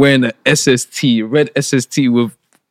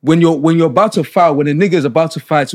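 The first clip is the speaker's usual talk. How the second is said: usual speaking voice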